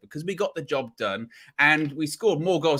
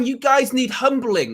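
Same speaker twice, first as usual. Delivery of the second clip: mocking rival fans; 6 semitones higher, 4 dB louder than usual. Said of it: "That's what they said".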